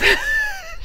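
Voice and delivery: high, sudden burst of laughter; amused laugh. Real, not acted. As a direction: burst out laughing at being teased. Laughs at being teased.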